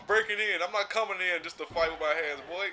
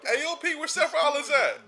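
surprised; 12 semitones higher, 6 dB louder than usual. Real, not acted. Surprised to see AOP.

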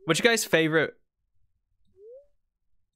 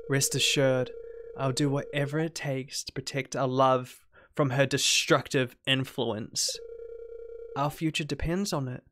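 soft, low game-character voice; devoted, ominous girlfriend; 5 semitones lower, 5 dB quieter than usual. Acted. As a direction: lovesick and quietly menacing, voiced low. Reads game character's dialogue.

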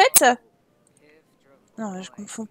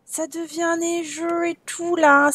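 slow, drawn-out marvelling; lingering, thrilled admiration; delighted wonder; 3 semitones higher, 4 dB louder, slower than usual. Real, not acted. Marvels at snow settling.

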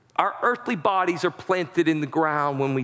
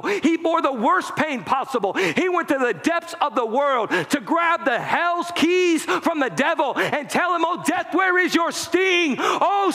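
full-force preaching; 10 semitones higher than usual. Acted. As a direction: preach it out with everything, crying to the crowd. Preacher builds to shouted climax.

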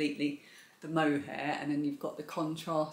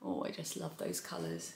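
soft breathy whisper of delight; 10 dB quieter than usual. Real, not acted. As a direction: whisper it lovingly. Gushing over the yarn colours.

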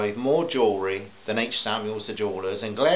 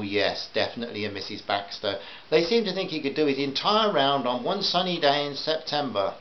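ordinary delivery

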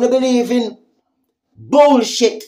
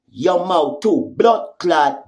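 normal talking voice

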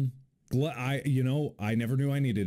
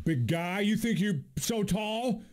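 higher, mock tough taunt; 7 semitones higher than usual. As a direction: square up to a tall guy. Taunting a tall character.

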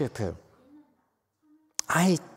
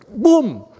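punchy shout; 11 semitones higher, 9 dB louder than usual. Shown as a demonstration. Teacher shows punchy word.

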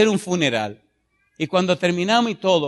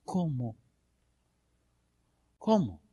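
much softer, dropped cómo; hushed echo; 4 semitones lower, 10 dB quieter, slower than usual. Acted. Preacher quietly repeating the question after a long pause. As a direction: let the question fall away.

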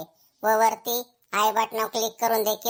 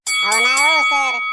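loud, slow whining denial; a pupil loudly denying to the teacher; loud drawn-out protest; 8 dB louder, slower than usual. Acted. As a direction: stretch it out loud like a caught pupil.

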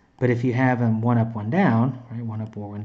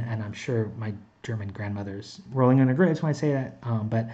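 ordinary talk, nothing marked

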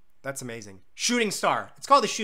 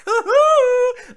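excited whoop; 14 dB louder than usual. Real, not acted. Delighted at the snail with a leaf umbrella.

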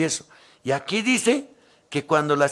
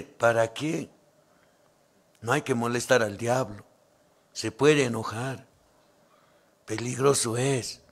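mock timid fear; 5 semitones lower, 5 dB quieter than usual. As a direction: act scared of upsetting the devil. Mocking a timid believer.

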